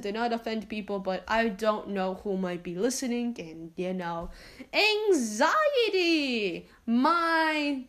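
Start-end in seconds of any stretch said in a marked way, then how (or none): 5.1-7.8 anxiety stretched very slow, high and louder, then falling; anxiety sung out slowly, high then sliding down; drawn-out word. Acted. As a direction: draw out anxiety like a long high moan. Naming what troubles her.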